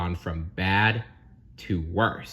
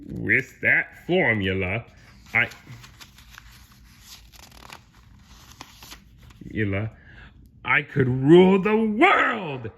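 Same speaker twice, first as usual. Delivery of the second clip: Plankton plotting; 5 semitones higher, 6 dB louder than usual. Acted. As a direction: build to a megalomaniac climax. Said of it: "talked to himself".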